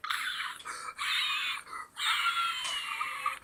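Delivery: very high thin squealed scream; retold scream. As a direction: let out piercing, drawn-out mock screams. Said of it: "I screamed".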